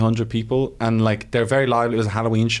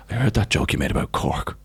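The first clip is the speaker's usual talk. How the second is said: confronting producer; 5 semitones lower than usual. Retold or imitated. Retelling producer's confrontation over the joke.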